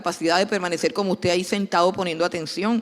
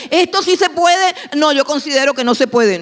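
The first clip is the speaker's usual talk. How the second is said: loud, high-pitched mock argument; bickering spouses; 6 semitones higher, 7 dB louder than usual. Spouses squabbling over disciplining a child, loud and insistent. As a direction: contradict each other with raised voices. Discusión tonta.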